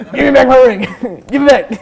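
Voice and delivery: loud, high acted insistence; insistent demand. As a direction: demand it back, half laughing. Figure demands ring back.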